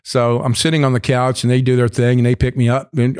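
ordinary talk

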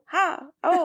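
playful pretend fright cry; mock startled gasp. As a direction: act a little jump scare, high and sharp.

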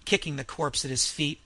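usual speaking voice

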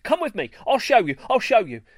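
fast, high eager rush. A narrator's reading. Greenstar rushing the crowd to the stable, eager. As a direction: hurry them along.

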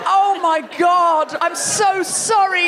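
high, slow, drawn-out apology; mortified apology. Real, not acted. Comic apologises to tourists expecting Shakespeare, drawn-out mortification. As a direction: stretch it out in comic horror.

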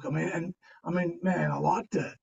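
ordinary talk, nothing marked

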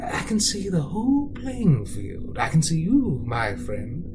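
a menacing storybook villain, slow and whispery at times; a creepy, theatrical villain narrator sliding into hushed whispers; sinister narrator. Acted. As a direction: taunt the listener like a scheming narrator. Villainous narrator taunts the listener.

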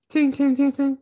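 high tinny ting ting; clinking noise. Retold or imitated. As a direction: mimic glass bottles clinking. Imitating bottles clinking.

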